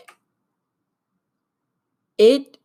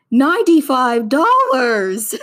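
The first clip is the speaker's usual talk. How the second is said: high, slow, small voice; high, slow, meek admission; timid confession; 6 semitones higher, 5 dB louder, slower than usual. Retold or imitated. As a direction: confess the number like a scared kid. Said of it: "That's exactly how I said it".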